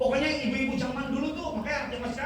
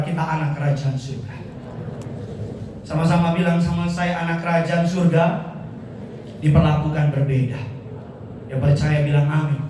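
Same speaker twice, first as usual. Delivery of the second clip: louder, rallying congregation lines; louder call-out to the church; call and response; 6 semitones lower, 6 dB louder than usual. Acted. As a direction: lead the crowd to repeat.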